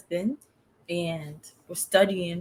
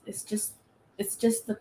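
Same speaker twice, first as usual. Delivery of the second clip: normal talking voice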